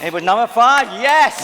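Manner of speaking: loud, high, triumphant announcement; excited cheer. Real, not acted. Judge celebrates the audition winner, excited and loud. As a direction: burst out delighted, rising to a shouted yes.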